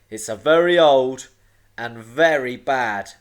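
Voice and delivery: loud, weighty island voice; big character voice, grave and loud; rumbling giant. A narrator's reading. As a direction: boom out the warning. Giant island Cliff explains the danger.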